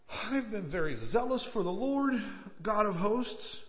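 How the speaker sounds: mock self-pitying complaint with pauses for effect; drama queen. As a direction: play the wronged prophet, overdramatic. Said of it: "Can you say drama queen a little bit here?".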